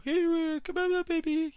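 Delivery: put-on very high-pitched voice coaxing a baby; awkward ten-year-old. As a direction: put on a squeaky falsetto, like a clueless kid. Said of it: "ten-year-old who doesn't know how to deal with babies".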